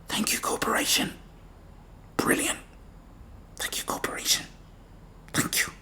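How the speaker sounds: whispered thanks fading to nothing; mock gratitude trailing off into a whisper.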